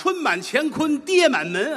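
slow, high, declaimed couplet; slow, high ceremonial recitation; reciting a couplet. Shown as a demonstration. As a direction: chant it like a festive couplet. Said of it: "下联儿呢".

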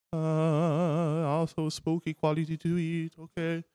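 usual speaking voice

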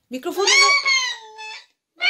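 very high squeaky sped-down mic voice; chipmunk voice. Pretend. Mother through squeaky mic.

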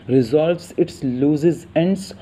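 slow, careful English read; measured textbook reading; slow definition read. Shown as a demonstration. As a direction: spell out the definition slowly. Reads English phrase slowly.

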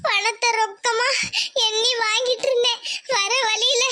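halting, sobbing account; crying. Acted. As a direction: choke through it, breaking into sobs. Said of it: "வந்து உட்கார்ந்து அழுதுட்டு இருக்கு". (விம்மி விம்மி அழுதபடி)

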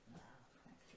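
soft unvoiced whisper of Nahverkehr. Shown as a demonstration. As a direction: mouth Nahverkehr in a breathless whisper. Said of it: "so nur leise". (flüsternd)

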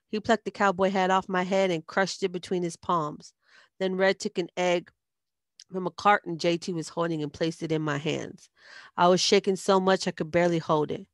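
ordinary delivery

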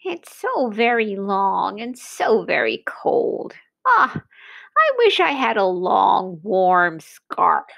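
mild, plaintive giraffe. A narrator's reading. Cold giraffe complaining to the giant about its neck. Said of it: "said the giraffe".

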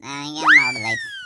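high, loud slurred jeer; drunk defiance. Acted. Drunk Shivappa brushing off his wife, high loud jeer. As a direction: toss it off like a reckless drunk. Drunk man defying warning.

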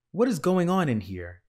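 bossy big-sister outburst; demanding question. A narrator's reading. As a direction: burst in demanding an answer.

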